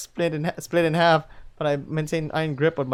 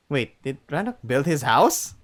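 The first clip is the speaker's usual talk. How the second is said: ordinary delivery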